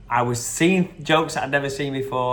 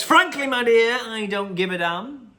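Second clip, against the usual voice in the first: movie line impression; 8 semitones higher, 3 dB louder than usual. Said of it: "Gone with the Wind".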